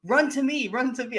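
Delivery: high-pitched mock urgent call. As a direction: call it out urgently to someone away. Acting a call down the alley.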